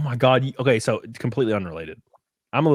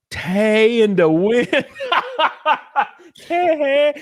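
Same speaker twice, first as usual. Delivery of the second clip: 1 imitating Nell; 12 semitones higher, 6 dB louder, much slower than usual. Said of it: "imitating the movie".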